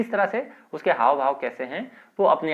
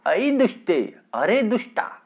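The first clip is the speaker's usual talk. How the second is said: high, sharp scold; 8 semitones higher, 3 dB louder than usual. Acted. Mother-in-law scolding Bimla, high and sharp. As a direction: scold her as a wicked girl.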